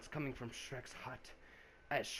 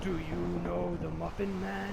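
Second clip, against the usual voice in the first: high, eerie call from the swamp; eerie muffin man voice; 4 semitones higher, 5 dB louder, slower than usual. Acted. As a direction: voice a spooky unseen stranger. The haunting voice in story.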